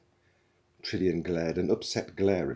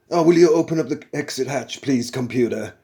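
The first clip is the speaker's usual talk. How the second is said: louder, higher, fast strained plea; strained patience; 7 semitones higher, 12 dB louder than usual. A narrator's reading. Zaphod asking the computer to open, strained patience. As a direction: hold back the anger. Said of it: "trying not to get angry".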